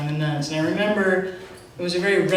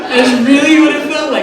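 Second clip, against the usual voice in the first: laughing; 7 semitones higher, 11 dB louder than usual. Real, not acted. Recalling miracle, moved.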